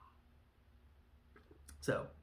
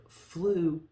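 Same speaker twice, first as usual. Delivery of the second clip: higher, louder disbelieving echo; incredulous; 7 semitones higher, 7 dB louder than usual. Retold or imitated. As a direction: scoff at the word. Cornelius disbelieving.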